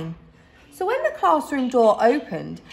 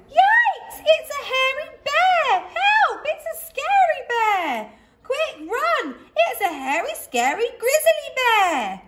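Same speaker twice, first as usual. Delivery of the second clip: rats screaming. A narrator's reading. Rats shrieking in panic as the bear looms over them. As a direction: screech and flee in terror.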